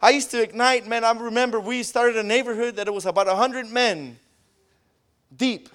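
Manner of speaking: ordinary talk, nothing marked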